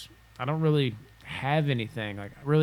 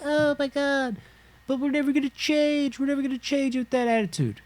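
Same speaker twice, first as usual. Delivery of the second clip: much higher, whiny exasperation; exasperated sensitive voice; 14 semitones higher than usual. Retold or imitated. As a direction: wail in a high mock despair. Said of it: "you can be like".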